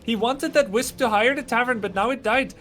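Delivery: high-pitched, excited Indian-accented commentary. Real, not acted. Caster lamenting the Wisp that died, Indian accent. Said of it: "talking with an Indian accent".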